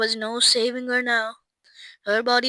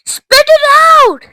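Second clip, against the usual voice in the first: high, loud order; urgent cry; 12 dB louder than usual. A narrator's reading. Mister Wonka to Violet chewing gum, crying out urgently. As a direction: cry out in alarm. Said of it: "cried Mister Wonka".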